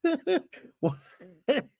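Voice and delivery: very high squeaky real laughing; helpless laughter. Real, not acted. Host finds the drama so stupid, real helpless laughter. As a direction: try to speak through a laughing fit.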